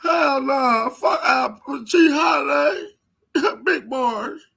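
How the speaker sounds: high, loud, tearful whine; hammed-up wailing caricature; mock crybaby voice. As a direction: shout it as if about to cry, mocking him. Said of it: "your ass about to cry".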